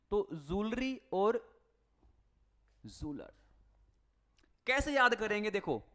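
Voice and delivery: ordinary delivery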